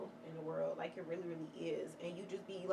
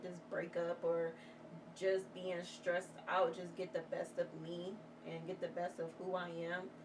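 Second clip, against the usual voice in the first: ordinary delivery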